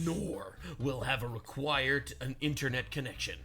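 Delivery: low lightly voiced Pirate Software. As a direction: keep the low imitation going. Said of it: "Doing the pirate voice".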